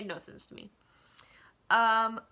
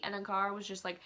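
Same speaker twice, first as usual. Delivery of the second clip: ordinary talk, nothing marked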